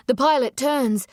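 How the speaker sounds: ordinary delivery, nothing marked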